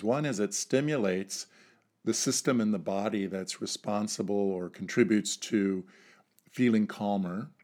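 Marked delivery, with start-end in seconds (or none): none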